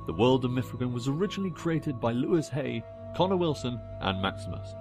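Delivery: ordinary delivery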